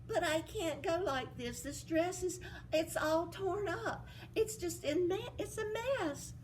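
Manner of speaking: lightly voiced fretting girl; Cinderella. Cinderella worried about dress.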